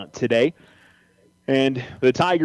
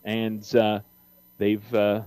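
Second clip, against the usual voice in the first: usual speaking voice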